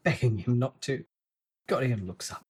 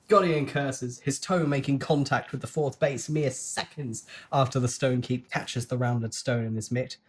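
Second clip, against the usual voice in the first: ordinary delivery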